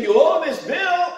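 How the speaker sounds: loud, slow demand; mock bill collector. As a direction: lay the debt on him like a collector. Preacher mimics a demanding bill.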